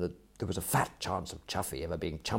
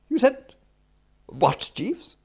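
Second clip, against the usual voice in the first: high, louder startled question; astonished; 8 dB louder, slower than usual. Acted. Employer hears valet's unexpected offer, astonished. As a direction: blurt it in stunned disbelief. Astonished, upsets the coffee pot.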